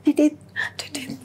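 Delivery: high two-note imitation of a beep; imitated sound. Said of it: "saya udah dengar suara".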